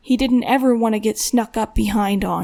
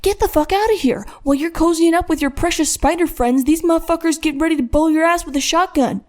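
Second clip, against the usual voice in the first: high, frantic, much higher scolding; panicked tirade; 7 semitones higher than usual. A narrator's reading. Buddy panicking, pushing the boys away from danger. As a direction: rush it out in a panic, pitch way up. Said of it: "finishing completely out of breath".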